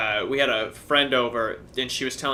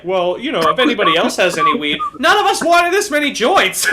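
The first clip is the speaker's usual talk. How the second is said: climbing higher and louder into laughter; laughing mock complaint; 12 semitones higher, 9 dB louder than usual. Laughing complaint to the friend about too many joints. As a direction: laugh your way up through the complaint. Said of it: "It's just like".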